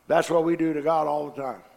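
normal talking voice

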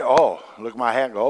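ordinary talk, nothing marked

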